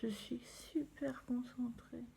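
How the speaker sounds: murmure concentré; chuchoté. Real, not acted. Concentrée sur ses sourcils. (chuchotement concentré)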